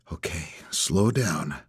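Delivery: low-key, slow and soft self-talk; slow it right down, softly, to yourself. A narrator's reading. Investigator reining himself in before questioning, quiet self-talk. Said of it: "I tell myself".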